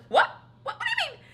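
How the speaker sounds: surprised. Retold or imitated. Shocked reply to mom.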